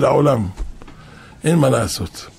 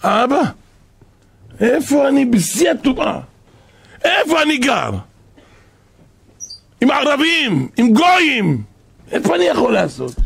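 desperate cry to father; 8 semitones higher than usual. Retold or imitated. Son calling out to his dead father, high desperate pleading shout.

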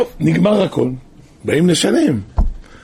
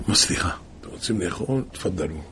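much softer and lower, quick and obliging; soft polite waiter; 9 semitones lower, 10 dB quieter, faster than usual. Retold or imitated. Waiter softly welcomes the poor family, polite and quick. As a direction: hush it like a courteous waiter.

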